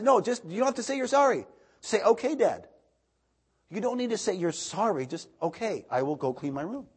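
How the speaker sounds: high, loud patient correction; lightly voiced coaching dad. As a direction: coax the boy toward okay Dad. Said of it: "I said".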